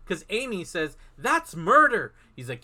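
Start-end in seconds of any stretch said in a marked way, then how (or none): 1.2-2.1 loud, high retold protest; mock shocked exclamation. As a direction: act out a shocked objection, louder and higher. Amy's shocked objection, retold.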